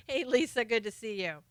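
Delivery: ordinary talk, nothing marked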